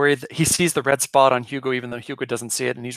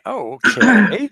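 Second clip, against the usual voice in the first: surprised; 9 semitones higher, 8 dB louder, slower than usual. Retold or imitated. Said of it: "I was thinking".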